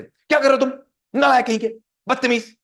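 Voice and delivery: loud, high scolding shout; acted furious telling-off; angry parent shouting. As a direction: bawl the child out. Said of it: "कैसे मैं बात करूंगा बच्चे से".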